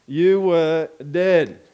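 slow, heavy "You were dead"; weighty, deliberate pronouncement; slow emphatic. Real, not acted. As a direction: lean hard on each word, slowly. Preacher stressing spiritual death.

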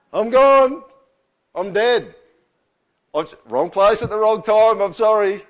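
loud, high-pitched mock despair; acted panicked outcry. Sinner caught in the presence of God, loud hammed-up panic. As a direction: call out like a doomed man, hammed up. Acting Isaiah doomed before God.

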